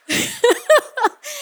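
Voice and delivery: a short high giggle at himself. Real, not acted. Preacher giggling at his stumble on stats. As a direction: break into a little laugh.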